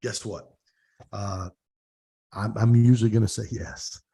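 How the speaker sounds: usual speaking voice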